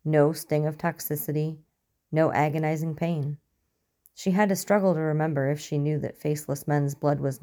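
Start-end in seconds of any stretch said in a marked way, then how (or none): none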